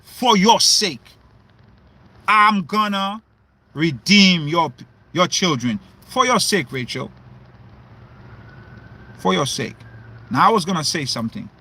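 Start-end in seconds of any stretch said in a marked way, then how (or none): 0.0-9.8 God's lowered, calm reply to Rachel; the Most High answering. Acted. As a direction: speak as God granting mercy, deep and unhurried. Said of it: "the Most High told Rachel what".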